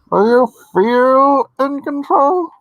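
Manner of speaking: high, loud and drawn-out line reading; mock voiceover. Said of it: "He says".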